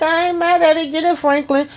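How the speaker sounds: high, delighted cheer; cheering him on. Real, not acted. Viewer cheering Franklin on while he works out, delighted. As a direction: hype him up like a fan.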